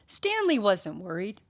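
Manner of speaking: plain reading voice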